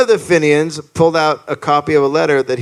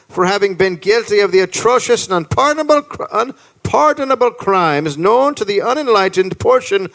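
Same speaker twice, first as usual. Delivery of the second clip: higher-pitched, rising declamation; letter read aloud; 5 semitones higher than usual. Acted. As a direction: build up a stirring proclamation. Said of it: "this is the letter he read".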